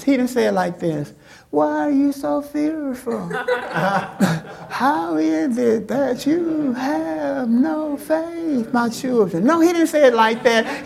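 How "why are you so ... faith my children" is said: soft, high, drawn-out coaxing; sweetly mild scolding, pitched up and stretched out; mock gentle Jesus. Shown as a demonstration. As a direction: croon it slowly, far too gently.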